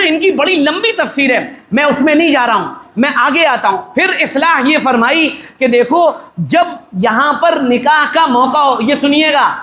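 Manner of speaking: ordinary talk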